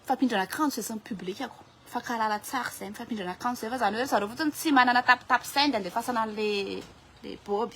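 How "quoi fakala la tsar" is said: ordinary delivery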